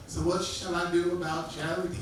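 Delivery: lightly voiced old woman pleading; a hint of a worried old lady. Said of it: "she started reasoning with me".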